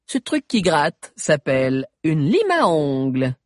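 put-on bright teen girl voice; girl character voice. A narrator's reading. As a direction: voice a chatty teenage girl. Sabi's girlish character voice.

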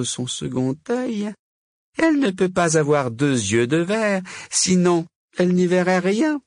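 high-pitched witch reassuring herself; Vipera. A narrator's reading. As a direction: argue away your worry, pitched high.

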